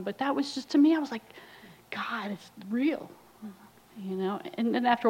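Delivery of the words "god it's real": slow, breathless whisper of awe; awed whisper. Retold or imitated. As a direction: hush it out slowly, stunned. Awed realisation in the retold moment.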